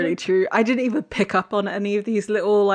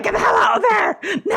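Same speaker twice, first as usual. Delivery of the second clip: high, loud hammed-up yell; mock urgent shout; 14 semitones higher, 5 dB louder than usual. Co-host to imagined diner, mock urgent shout. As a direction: yell a pretend warning to flee. Acts out urging diner to flee.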